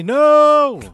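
loud, high, long-drawn yell on "no"; shouted refusal. Acted. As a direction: refuse at the top of your voice, stretching the last word. Player refuses the dream waiter, shouting.

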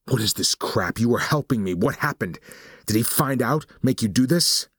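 betrayed, voice climbing with each question; frustrated and upset. Acted. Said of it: "so frustrated and upset".

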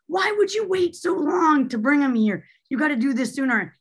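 high-pitched mock scolding; retold yelling. Pediatrician scolding a mother for waiting, high retold yell. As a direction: imitate an exasperated telling-off, pitch up without much volume. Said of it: "she was yelling at me like".

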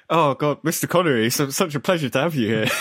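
hammed-up Scottish accent; mock Glaswegian. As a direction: greet him like a fawning Scottish host. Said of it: "Somehow I've gone Glaswegian".

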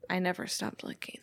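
breathy whisper of a movie line; mock-dramatic whispered reply. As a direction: whisper it like a hushed vow. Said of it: "And Johnny Utah says".

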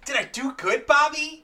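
very high, eager mock-childlike voice; Chad Gable. Gable seeking Bobby's approval, high mocking imitation. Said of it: "and then goes".

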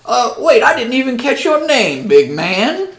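mayor welcoming the strangers, high and loud. Acted. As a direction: play the town mayor, pitched high and eager. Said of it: "the mayor says".

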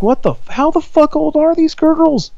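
dad reacting, startled and high-pitched; shocked outburst. Startled dad shocked by his daughter's friends, voice jumping high. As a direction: voice jumps high in disbelief. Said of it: "I'm like".

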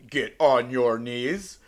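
slow, loud, deliberate command; drawn-out, carefully articulated dirty talk; very clear enunciation. Shown as a demonstration. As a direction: spell out each word as an order. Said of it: "you are enunciating very clearly and speaking very".

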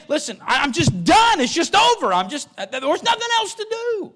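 loud, high plea to God, worn out and giving up; Elijah crying out. Acted. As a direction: pour out Elijah's despair at God. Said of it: "He said".

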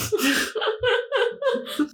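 high, rolling laughter; real laugh. Real, not acted. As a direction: crack up in high giggles. Laughs at the mix-up.

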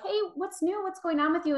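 lightly acted chatty greeting; casual greeting. Acted grocery store small talk greeting.

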